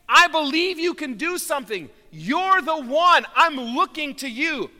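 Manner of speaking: preacher voices a believer's loud plea; loud pleading believer. Acted. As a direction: cry out as the desperate woman reaching for Jesus. Voicing the desperate believer.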